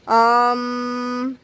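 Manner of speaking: drawn-out hesitant um; nervous. A narrator's reading. Harold hesitating before confessing to George, nervous. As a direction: stall uneasily on a long hum. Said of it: "said Harold nervously".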